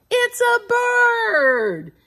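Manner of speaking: high, slow shouted reveal; excited shout. Acted. Host revealing the bird to children, shouting excitedly. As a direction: shout out the answer to the kids.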